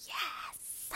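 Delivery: excited breathy whisper. Real, not acted. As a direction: hiss it with secret glee. Excited private reaction to gift.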